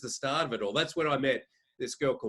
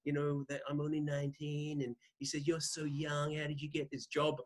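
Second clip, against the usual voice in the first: soft lisping boxer; 3 semitones higher, 7 dB quieter than usual. Retold or imitated. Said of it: "I'm sounding more like [name]".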